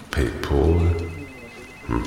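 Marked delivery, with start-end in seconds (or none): 0.1-0.9 PayPal with a rounded "pol" ending, softly; quiet, tentative PayPal ending in "pole"; trying out pronunciation. Shown as a demonstration. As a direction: try "PayPal" as "pay-pole", unsure. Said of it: "wie man es richtig ausspricht".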